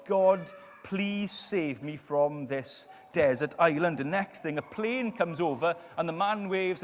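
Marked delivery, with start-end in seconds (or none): 0.1-4.0 loud, drawn-out mock plea; pleading prayer. Stranded man prays to be saved, loud slow mock plea. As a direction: pray loudly and slowly, imitating a desperate man. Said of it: "So he prays to God".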